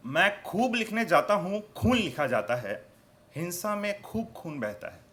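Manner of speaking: declaimed verse, pausing between the last words; poetry recitation. Acted. Poet recites on bloodshed to listeners, declaimed with pauses. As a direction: lift each line's start, let the end fall.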